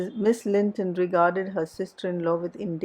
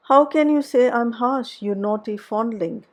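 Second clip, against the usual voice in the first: sharp, louder amazed outcry, pitch jumping up; amazed cry; 4 semitones higher, 7 dB louder than usual. A narrator's reading. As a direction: cry out in disbelief at the accusation. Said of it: "cried the mistress, amazed".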